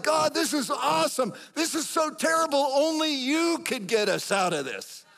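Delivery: high, loud, hammed-up elation; exultant cry to God. Believer boasting to God in a terrible mess, high exultant cry. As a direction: call it upward with full confidence.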